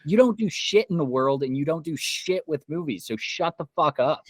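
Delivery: mocking the troll with real anger; angry mockery. Real, not acted. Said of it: "I'm so angry right now".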